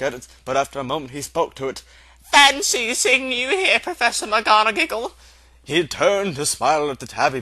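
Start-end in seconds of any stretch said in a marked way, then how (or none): 2.2-5.1 Dumbledore's voice. Acted. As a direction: greet her with a knowing twinkle. Dumbledore greets the cat.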